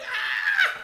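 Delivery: loud, very high mock shriek; piercing storyteller's scream; re-enacted scream. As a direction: shriek as if struck, acting it out. I'm screaming.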